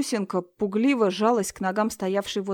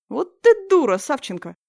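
put-on smirking boy; 6 semitones higher, 7 dB louder than usual. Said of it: "хмыкнул Вовка".

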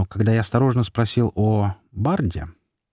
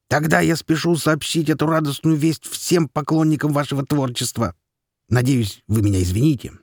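gnome voice; 5 semitones higher than usual. Acted. Гном в зале, голос персонажа.

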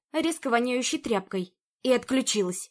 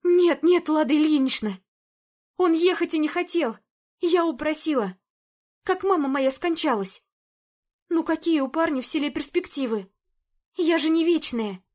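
pleading housekeeper; 5 semitones higher, 4 dB louder than usual. Acted. Zina pleading with Lada.

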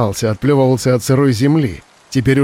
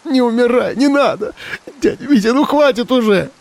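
high, sobbing plea; 12 semitones higher than usual. Acted. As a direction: beg through heavy sobs. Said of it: "Её слов было не разобрать из-за рыданий".

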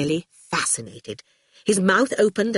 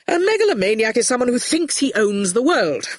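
higher, emphatic argument; indignant explaining; 6 semitones higher than usual. A narrator's reading. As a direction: explain it hotly. Quentin arguing in the row.

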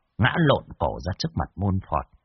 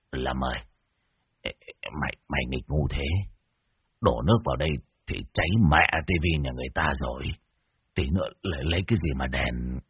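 much lower, softer, whining complaint; whimpering scold; 8 semitones lower, 5 dB quieter than usual. Acted. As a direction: grumble half in tears at the foolish helper. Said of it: "Thầy Muôn Phọt mếu máo". (mếu máo trách móc, giọng trầm và nhỏ hẳn)

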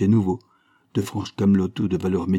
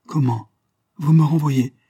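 a higher, louder stunned cry of disbelief; dismayed; 6 semitones higher, 5 dB louder than usual. A narrator's reading. Young woman stunned to hear she is fired. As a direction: gasp it out as if struck. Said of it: "fit Elsa d'un air atterré".

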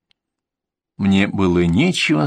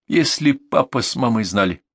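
ordinary delivery

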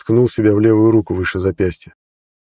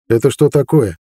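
puzzled; 3 semitones higher than usual. A narrator's reading. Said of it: "озадаченно спросил Харалд".

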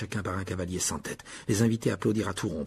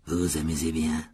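low, slow ghostly host; Nick's low voice; 6 semitones lower, slower than usual. A narrator's reading. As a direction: ask slowly in a lowered pitch. Nick accueille ses invités. (voix grave et lente)

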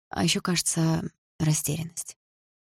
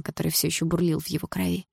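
plain reading voice

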